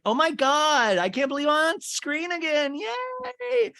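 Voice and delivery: mock excited squeal. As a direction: squeal it like a thrilled fan back on camera. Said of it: "And Angie's like".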